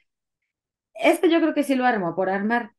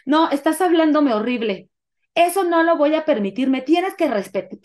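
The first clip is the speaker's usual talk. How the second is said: louder, higher imitation of an outraged partner; hysterical outrage; 6 semitones higher, 4 dB louder than usual. Partner demanding respect in a retold quarrel, hysterical outrage. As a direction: act out an affronted, worked-up tirade. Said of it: "Se puso histérico".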